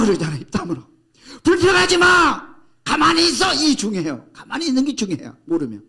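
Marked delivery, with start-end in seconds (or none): 0.4-3.5 high shouted scolding, slowing down; angry rebuke. Acted. Moses shouting down the complaining people, angry and loud. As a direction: yell at the grumbling crowd.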